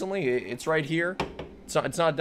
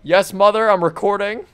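louder, called to another room; calling out; 3 semitones higher, 9 dB louder than usual. Real, not acted. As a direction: call back to your mom in the house. Calling out to his mother.

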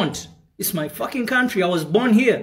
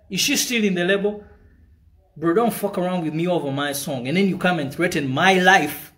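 ordinary talk, nothing marked

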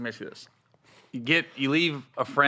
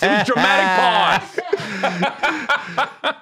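laughing; 3 semitones higher, 4 dB louder, much slower than usual. Real, not acted. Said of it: "Way to break the fucking pause".

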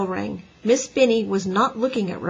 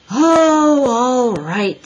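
loud, high exasperated groan of surrender; cross; 4 semitones higher, 8 dB louder, much slower than usual. A narrator's reading. Boy gives in to howling girl, cross. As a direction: give in with drawn-out irritation. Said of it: "said Henry crossly".

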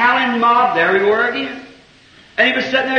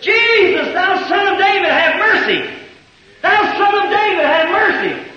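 desperate cry; 8 semitones higher than usual. Retold or imitated. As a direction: call over the crowd for mercy. Blind beggar crying out to Jesus.

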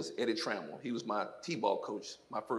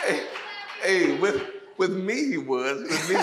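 laughing tease; 6 semitones higher, 7 dB louder, slower than usual. Real, not acted. Joking about patient coach.

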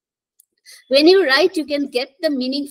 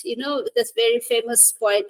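ordinary talk, nothing marked

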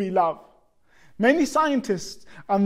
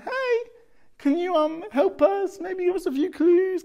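much higher, small pleading voice; sheepish plea for help; 9 semitones higher than usual. Retold or imitated. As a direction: play it like a sheepish kid asking for help. Retelling calling staff for help.

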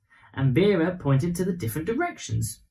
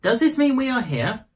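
put-on child voice; 8 semitones higher than usual. A narrator's reading. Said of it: "Vera read out loud".

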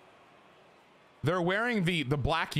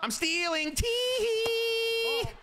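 very high, teasing sing-song; gleeful sing-song; much slower than usual. Real, not acted. Gamer gloating over a stolen base, gleeful high sing-song. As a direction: squeal it with a long held tee-hee.

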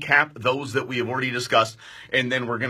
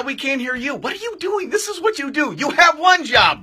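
high-pitched playful scolding; mock exasperation; 12 semitones higher, 4 dB louder than usual. Real, not acted. Host ribbing producer Bree over the lost mic, playfully exasperated. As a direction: tease her like she blew it.

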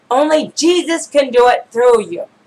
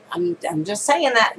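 ordinary talk

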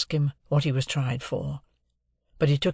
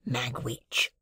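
hushed, whispered reply; whispered answer; 6 dB quieter than usual. A narrator's reading. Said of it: "he answered in the same tone".

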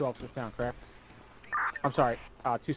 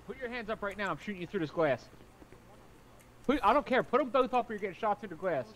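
shouting commands; 7 semitones higher than usual. Acted. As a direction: bark orders at the suspect. Officer commanding armed suspect.

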